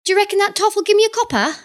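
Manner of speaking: high, quick cockney urchin; cheeky street urchin. Acted. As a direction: chirp it like a street kid. GM voices the cockney boy.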